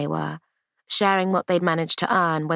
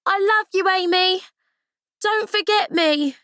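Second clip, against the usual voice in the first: higher, calling across the dock; shouted farewell; 14 semitones higher than usual. Acted. Ruth calls to Amy boarding the ship, shouting. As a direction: call it out to a departing friend. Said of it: "she heard Ruth shout".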